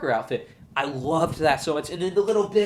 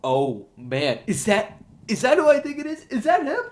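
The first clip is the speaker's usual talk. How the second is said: rising, louder, astonished fan voice; high, loud disbelief, building up; excited gasp; 5 semitones higher, 5 dB louder than usual. Retold or imitated. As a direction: act out the audience realizing who it is. Excited fan reaction, acted out.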